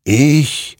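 high, loud, weighty single word; creature's answer. A narrator's reading. Creature claims victory to Hector, weightily. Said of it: "murmelte dieser".

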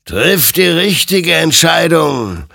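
loud, high, drawn-out warning; slow, commanding exhortation; stern admonition. A narrator's reading. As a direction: admonish like an ominous phantom. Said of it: "ermahnte ihn sein Gegenüber".

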